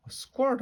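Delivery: high-pitched squirt sound effect; pretend squirting. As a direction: squeak it like a toy spray. Pretends to squirt herself with seltzer.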